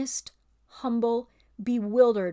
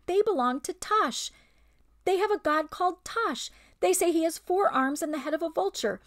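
put-on much higher, young lamb; lamb's high voice; 8 semitones higher than usual. Lamb questions the ape, high and innocent. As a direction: ask innocently, like a young child. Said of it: "high voice of a woolly lamb".

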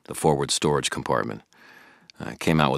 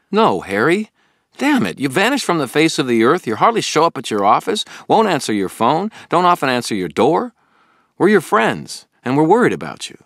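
much higher, louder pleading anger; confronting; 14 semitones higher, 12 dB louder than usual. Acted. Billy planting his feet to confront his friend Harry, loud and upset. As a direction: stand your ground and make him listen.

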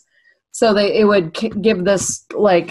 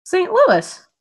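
excited; 12 semitones higher than usual. Real, not acted. Surprised reading the label.

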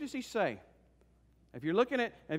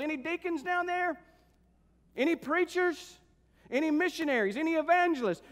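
high, searching calls to the crowd; urgent pulpit challenge, pitched high; fervent preaching; 10 semitones higher, 6 dB louder than usual. Acted. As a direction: call out each group, then wait. Preacher calls for someone worthy.

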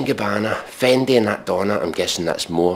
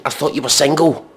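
rising higher and higher in disbelief; exasperated rant; 7 semitones higher, 4 dB louder, faster than usual. Real, not acted. Comedian arguing with a pop song, exasperated. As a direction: get more incredulous with every question. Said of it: "ranting at the lyrics".

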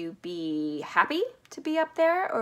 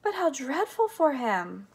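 high, slow cry of dismay; 6 semitones higher, 4 dB louder than usual. A narrator's reading. Charlie feeling for the overstretched boy, crying out in dismay. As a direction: exclaim in drawn-out, higher-pitched horror. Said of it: "cried Charlie".

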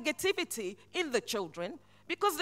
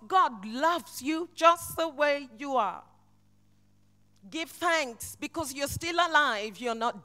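louder with each whatever, building to God loves you; preaching at full force; 6 semitones higher, 8 dB louder than usual. Acted. Preacher to congregation with troubles at home, building preaching cry. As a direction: drive each whatever home, slowing at the end.

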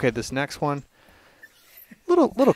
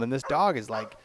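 usual speaking voice

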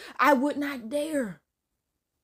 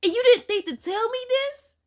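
high, rising disbelief; incredulous; 12 semitones higher, 5 dB louder, slower than usual. Real, not acted. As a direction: demand an answer in shocked disbelief. Listener reacts indignantly to the story.

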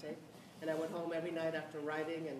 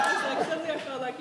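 laughing; 8 semitones higher, 8 dB louder than usual. Real, not acted. Laughing, still elated.

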